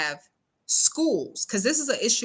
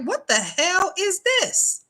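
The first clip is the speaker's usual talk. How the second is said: 1 shocked parent; 10 semitones higher, slower than usual. Retold or imitated. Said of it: "and you're like".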